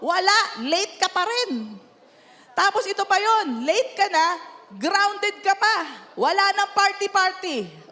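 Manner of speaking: mock strict parent grounding teen. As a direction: lay down the punishment. Parent voiced punishing teen.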